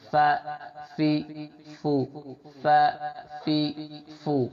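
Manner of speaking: فا فی فو each said crisply on its own; drilling syllables. Shown as a demonstration. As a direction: sound out فا فی فو one by one. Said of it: "like this".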